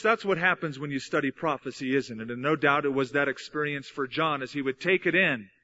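ordinary talk, nothing marked

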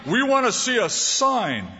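high, sneering demand; mock the demand for a sign; mocking Pharisees. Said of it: "it sounds funny".

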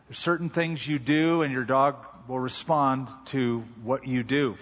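ordinary delivery

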